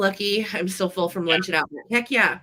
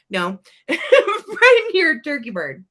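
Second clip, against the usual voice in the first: slow, high, loud through laughter; laughing self-correction; 8 semitones higher, 8 dB louder, slower than usual. Real, not acted. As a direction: laugh through your own slip. Laughing at own hot dog slip.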